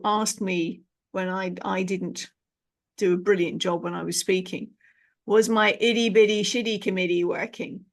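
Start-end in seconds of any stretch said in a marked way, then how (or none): 5.3-7.8 put-on slight Canadian accent. Quoting a Canadian client's phrase, a slight Canadian accent. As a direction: quote the Canadian lady with a hint of Canadian accent, "itty" as "iddy". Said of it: "I have to put the slightly Canadian accent on".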